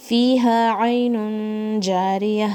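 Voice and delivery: slow, measured tajweed recitation; Quran recitation. Shown as a demonstration. Teacher recites the verse for her students, slow and measured. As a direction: chant the verse slowly for students.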